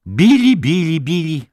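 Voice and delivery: high, loud lamenting repetition of the name; sighed reproach. A narrator's reading. Head nurse chiding frightened Billy, reproachful lament. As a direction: chide him with exasperated pity. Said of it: "westchnęła".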